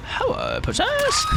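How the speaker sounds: high swooping princess greeting; sing-song cartoon princess hello. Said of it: "like, the princess voice".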